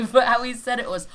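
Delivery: usual speaking voice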